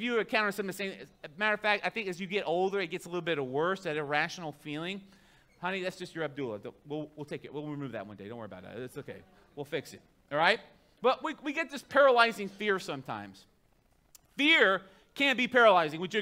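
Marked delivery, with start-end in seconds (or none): 5.6-10.6 reassuring husband. Pretend. Soothing his wife about her fear.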